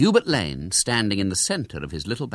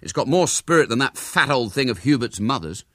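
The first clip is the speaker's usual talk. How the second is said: higher, emphatic pride; proud; 4 semitones higher, 5 dB louder than usual. A narrator's reading. William compares his cat with Hubert's mother's, proud. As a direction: boast stoutly on its behalf. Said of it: "said William proudly".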